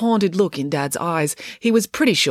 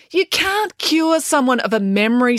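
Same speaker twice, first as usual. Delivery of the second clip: high, slow, indignant; indignant retort; 9 semitones higher, 4 dB louder than usual. Acted. April retorting about her therapy, indignant. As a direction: correct them with drawn-out indignation.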